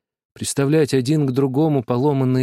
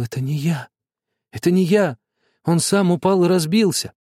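stubborn denial, rising higher; stubborn, frightened; 5 semitones higher than usual. A narrator's reading. Boy stubbornly repeats his denial to father, fearful. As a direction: insist stubbornly, scared inside. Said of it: "я упрямо, с ужасом в сердце повторял".

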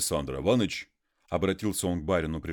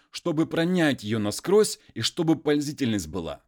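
higher, louder indignant muzhik; worked-up peasant; 8 semitones higher, 6 dB louder than usual. Acted. Konstantin complains of his wife to the master, raised and indignant. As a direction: rail against the wife, raised and loud.

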